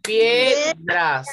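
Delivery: PIEDRAS as PIE, pause, DRAS; two separate syllables. Shown as a demonstration. Teacher asking how it would divide, two separate syllables. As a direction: keep pie together, then a separate dras. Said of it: "solo tiene dos".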